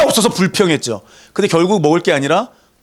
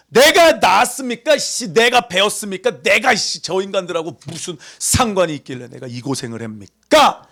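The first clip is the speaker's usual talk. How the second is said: acted-out resentful complaint; 4 semitones higher than usual. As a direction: play an exasperated leader venting at God. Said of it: "그 원망을 사람들에게 가서 풀지 않습니다". (억울해서 따지는 원망 흉내)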